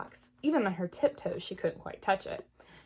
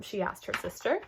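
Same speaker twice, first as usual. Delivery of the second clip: usual speaking voice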